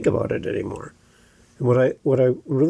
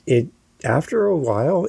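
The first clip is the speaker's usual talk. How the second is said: ordinary delivery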